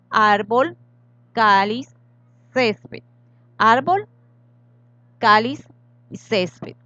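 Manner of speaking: Árbol, cáliz, césped con la primera sílaba fuerte; acento en la penúltima. Shown as a demonstration. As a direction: marcar ÁR-bol, CÁ-liz, CÉS-ped, palabra por palabra. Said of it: "tienen el acento en la penúltima sílaba". (árbol, cáliz, césped con golpe de voz inicial)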